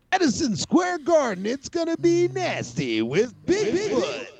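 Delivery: booming high radio ad hype; monster truck ad. Retold or imitated. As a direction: shout it like a monster truck commercial. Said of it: "fun with sound processing".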